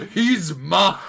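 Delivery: high, loud claim on the kill; hammed-up territorial yell; possessive mock shout. Friend re-enacting the kill claim he loved, shouting.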